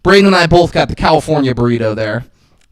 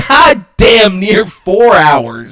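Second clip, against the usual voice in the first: exasperated shout; 5 semitones higher, 5 dB louder, much slower than usual. Retold or imitated. Exasperated retelling.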